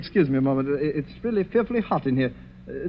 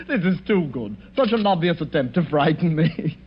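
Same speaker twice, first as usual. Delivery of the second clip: ordinary talk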